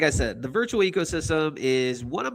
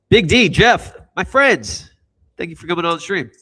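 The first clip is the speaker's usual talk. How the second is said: big, booming thank-you to the guests; loud send-off; 6 semitones higher, 9 dB louder than usual. Real, not acted. Host cheering off his departing guests, loud and hyped. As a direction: hype them up as they leave.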